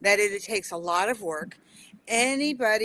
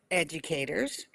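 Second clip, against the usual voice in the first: usual speaking voice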